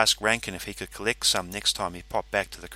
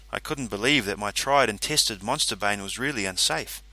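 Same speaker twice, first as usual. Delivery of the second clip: plain reading voice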